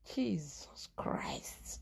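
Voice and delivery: breathy whisper under the breath; whispered aside. Real, not acted. Whispering in disbelief at the story.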